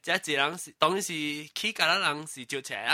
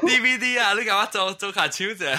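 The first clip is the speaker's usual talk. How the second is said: higher and louder, laughing into it; excited teasing; 4 semitones higher, 5 dB louder than usual. Real, not acted. Teasing a friend about borrowing blue films, excited and laughing. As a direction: push the tease with a laugh.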